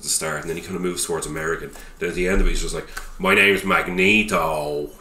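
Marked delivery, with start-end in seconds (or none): 3.2-4.9 put-on slow, loud, broad Irish accent; mock-dramatic Irish accent, drawn out; pure Irish accent. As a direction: declare it grandly in broad Irish. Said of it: "Just pure Irish".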